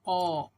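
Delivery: "ओ" as a loud "aw"; AW vowel. Shown as a demonstration. Teacher presenting the next vowel, "ओ" said as a loud "aw". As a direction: sound out "ओ" like the English letters AW. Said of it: "जैसे ए डब्ल्यू".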